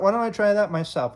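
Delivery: ordinary delivery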